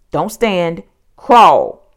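firm warning rising to a loud command; forceful, loud instruction; urgent order. A narrator's reading. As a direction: order it urgently, punching the last word. Jack orders Annie in danger.

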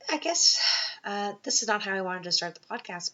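tearful voice catching on the words; holding back tears as she speaks; emotional. Real, not acted. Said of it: "maybe I sound a little bit emotional".

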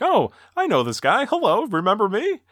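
high, oblivious cheery greeting; C-3PO. Retold or imitated. Robot missing the emotional moment, high and cheery. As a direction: chirp it like a clueless acquaintance. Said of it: "To him, it's just like".

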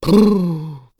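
loud blown lip trill; exclaimed brrr. A narrator's reading. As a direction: blow out an exasperated shudder. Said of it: "udbrød Hyggen".

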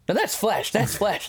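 high, goofy mock excitement breaking into laughter; excited. Host acts out Quintus on flesh and bones, excited. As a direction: retell it with gleeful excitement, laughing midway. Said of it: "he's just so excited about it".

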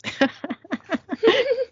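giggle. Real, not acted. Laughing at Rowena line.

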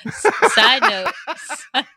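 slow, broken up by laughter; laughing. Real, not acted. Wife tries to change subject, laughing. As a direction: struggle to speak through the giggles. Trying to resume while cracking up.

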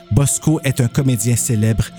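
usual speaking voice

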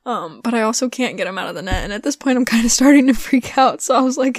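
normal talking voice

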